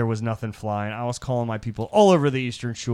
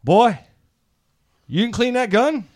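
imitating dad; 10 semitones higher, 8 dB louder than usual. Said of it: "he's like".

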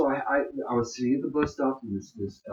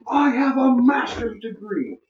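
imitating a shout, much higher, slow and drawn out; retold yell; 11 semitones higher, 5 dB louder, slower than usual. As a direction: fling it back from down the street. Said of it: "I could just hear him yelling".

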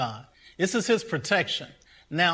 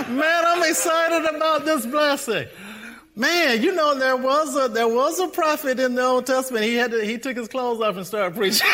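high, raised, giddy voice; real excitement; 10 semitones higher, 5 dB louder than usual. Real, not acted. Preacher joking about a prophet who preached unclothed, giddy excitement. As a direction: tell it giddily, tipping into laughter.

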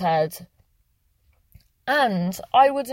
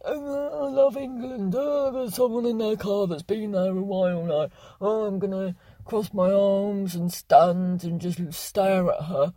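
mock impression of the man; 4 dB quieter than usual. Mocking the staring man.

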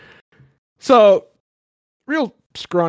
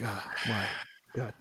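embarrassed groan; 3 semitones lower, 16 dB quieter than usual. Acted. Teen embarrassed by parents.

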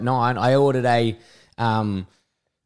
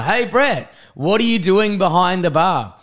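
higher-pitched mock greeting from a mate; surprised greeting; 6 semitones higher, 3 dB louder than usual. Mate walking into the bar, surprised greeting. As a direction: call out a puzzled hello, acting the mate.

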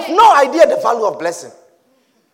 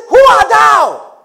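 booming Who art thou, slow; slow shouted challenge; loud demanding question; 9 semitones higher, 8 dB louder, slower than usual. Acted. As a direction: thunder the question as old Isaac. Preacher repeats Isaac's question.

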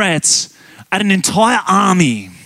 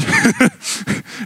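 a very high, soft squeal of a laugh; 4 dB quieter than usual. Real, not acted. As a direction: let a tiny high squeal escape. Preacher laughs after David quote.

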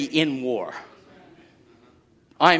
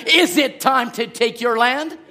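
rising to a full shout, higher and louder; preaching shout; 7 semitones higher, 4 dB louder than usual. Real, not acted. Preacher to the saints, full-force shout. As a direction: claim the land at the top of your voice.

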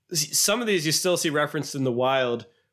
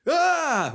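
very high, sudden startle yell; loud yell; 14 semitones higher, 3 dB louder than usual. Retold or imitated. Guy filming from top of stairs, sudden high yell. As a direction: imitate a prankster's high scream. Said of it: "really loud".

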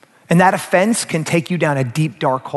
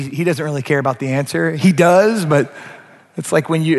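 ordinary talk, nothing marked

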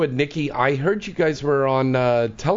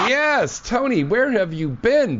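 excited greeting; 12 semitones higher, slower than usual. Real, not acted. Host greets caller loudly.

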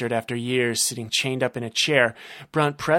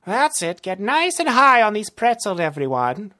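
high, raised villain voice; villain urging on his men; gloating villain; 10 semitones higher, 5 dB louder than usual. Acted. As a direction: cheer the henchmen on. Narrator voices Rumble.

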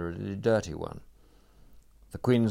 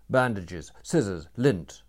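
ordering; 7 dB louder than usual. A narrator's reading. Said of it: "ordered Sebastian".

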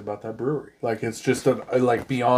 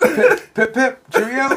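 higher hammed-up British accent, pip pip cheerio; mock British accent; 11 semitones higher, 5 dB louder, slower than usual. Cohost jokes about the son's feared British accent, mock British accent. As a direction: toss off a silly British accent for a laugh. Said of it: "British accent".

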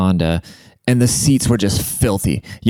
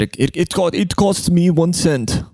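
put-on a light Greek accent, slow and high; 9 semitones higher than usual. Uber driver explaining electric costs, light Greek accent. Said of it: "spoke French better than English".

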